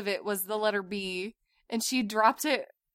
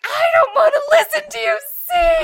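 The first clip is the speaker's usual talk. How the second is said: put-on very high, loud, crying child's shout; mock tantrum shout; 12 dB louder than usual. Mom imitating tired daughter yelling in the car, very high and loud. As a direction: yell it like a tired toddler. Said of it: "she's like".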